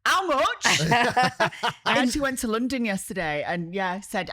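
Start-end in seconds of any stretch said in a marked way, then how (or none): 0.0-0.7 "How much?" in broad Yorkshire, pitched up and loud. Pretend. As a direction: bark out "How much?" like a tight Yorkshire lass. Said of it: "a cup that says".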